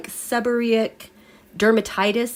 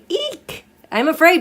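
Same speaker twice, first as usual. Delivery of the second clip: squeaky, high mock-scared yelp on Eek, then a mock-afraid line; mock fright; 7 semitones higher than usual. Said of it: "I kept saying".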